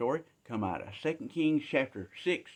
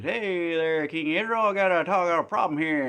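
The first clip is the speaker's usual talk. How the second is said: put-on old woman calling out; 5 semitones higher, 5 dB louder than usual. Woman calls to the king.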